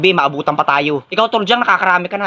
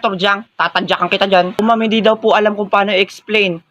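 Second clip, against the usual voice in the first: ordinary delivery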